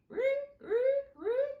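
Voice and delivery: high, loud mock frog croaking; playful imitation of frogs singing; frog croaks. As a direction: croak like a night frog for the kids. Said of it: "You can hear the frogs going".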